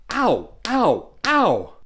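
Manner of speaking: three high, loud ows, building; acted yelps of pain. Boy slapped by teacher's ruler, building acted yelps.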